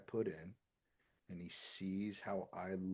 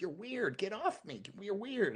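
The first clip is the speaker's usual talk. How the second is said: higher mock kid whine; imitating his son; 12 semitones higher, 4 dB louder than usual. Father retelling his son's protest, in a lightly voiced higher kid whine. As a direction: play the squirming kid, higher.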